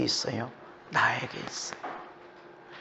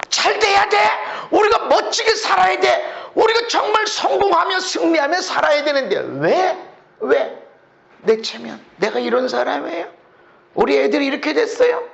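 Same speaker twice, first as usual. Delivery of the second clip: higher, louder demanding voice; mock selfish believer; 8 dB louder than usual. Preacher mocking a believer obsessed with his own pride, loud whine. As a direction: whine about your own success.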